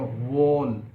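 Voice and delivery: वॉल said slowly with rounded lips. Shown as a demonstration. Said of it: "हमारे lips round".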